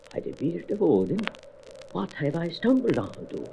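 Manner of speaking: put-on high, quiet old fisherman's voice; an aged fisherman startled by what he sees; hushed, high old sailor's astonishment. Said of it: "The eyes of an aged fisherman".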